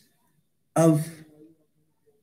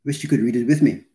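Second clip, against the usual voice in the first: ordinary talk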